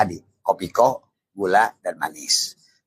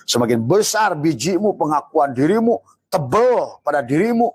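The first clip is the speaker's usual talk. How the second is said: rising preaching fervor; 8 semitones higher than usual. Real, not acted. Preacher builds to a peak.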